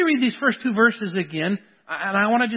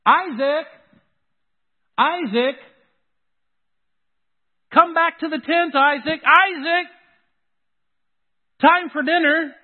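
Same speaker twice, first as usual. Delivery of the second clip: raised, higher call across the camp; 6 semitones higher, 4 dB louder than usual. Acted. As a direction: call the boy home for dinner. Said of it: "every time they call his name, just think about it".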